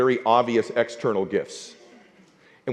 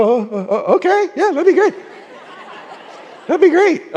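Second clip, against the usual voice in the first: high, flustered, giggling yes; overwhelmed fan accepting a hero's offer; flustered delight. Retold or imitated. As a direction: stammer out a thrilled acceptance. Flustered reply to hero.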